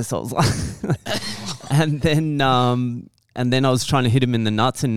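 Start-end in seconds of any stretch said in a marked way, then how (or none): none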